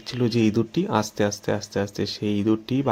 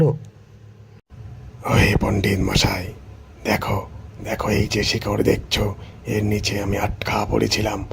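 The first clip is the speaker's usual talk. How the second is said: hungry tiger; 2 semitones lower than usual. Acted. Tiger states its case.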